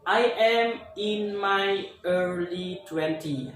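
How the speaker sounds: "I am in my early twenty" said slowly, word by word; slow example sentence. Shown as a demonstration. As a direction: model it slowly for the class. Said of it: "bisa mengucapkannya kayak gini". (ucapkan pelan dan jelas)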